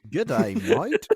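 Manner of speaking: foreigner attempting an Aussie greeting; put-on Australian accent, lifting at the end; mock Australian accent. Co-host joining the French-podcast bit, mock Australian accent. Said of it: "trying to do an Australian accent".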